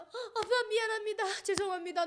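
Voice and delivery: high, pleading, tearful sorry; tearful apology. Retold or imitated. Daughter apologizing to her tortured father, tearful. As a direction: beg forgiveness through tears, voice high.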